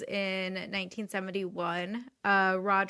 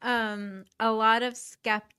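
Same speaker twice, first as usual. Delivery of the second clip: ordinary talk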